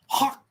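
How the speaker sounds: mock hawking up spit; spitting catchphrase. Shown as a demonstration. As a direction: imitate a big throaty spit. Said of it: "the old glick goes".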